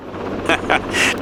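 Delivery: short, soft chuckle; announcer's chuckle. Acted. Announcer amused by tiny billboard clowns, chuckling. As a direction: chuckle softly to yourself.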